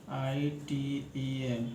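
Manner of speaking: each letter stretched slowly; slow spelling. Shown as a demonstration. Teacher spelling item for students, drawn out slowly. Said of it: "teacher spells word slowly".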